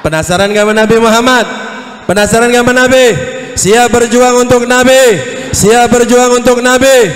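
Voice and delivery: loud preaching shout; rallying preacher. Real, not acted. Preacher asking congregation to fight for the Prophet, rallying shout. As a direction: fire up the crowd with each question.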